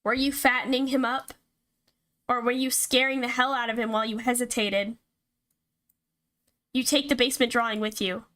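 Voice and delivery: ordinary talk, nothing marked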